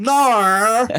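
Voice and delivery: mock Australian. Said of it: "mocking Australians".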